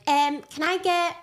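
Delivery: put-on high, slow Glasgow shop-counter accent. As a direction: order slowly in a put-on Glaswegian accent. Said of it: "I'll do it as well in, like, Glas".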